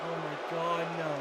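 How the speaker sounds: low, very soft pleading; hushed, sinking dismay; crestfallen murmur. Real, not acted. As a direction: watch helplessly, the voice drained. Commentator watches favourite being beaten.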